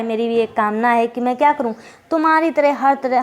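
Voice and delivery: ordinary talk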